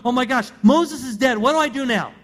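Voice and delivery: panicked. Retold or imitated. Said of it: "But now he's going".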